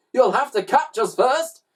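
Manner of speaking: high, loud taunt; exclaimed. A narrator's reading. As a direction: exclaim it as a fleeing villain. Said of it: "exclaimed Mister Glutton".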